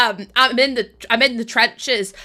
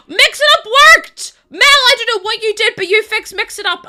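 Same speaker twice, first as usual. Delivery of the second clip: gasp, then high, loud thrilled cry; squealing happy surprise; delighted gasp; 10 semitones higher, 8 dB louder than usual. Real, not acted. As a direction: explode with relief and joy. Tool suddenly works after failing.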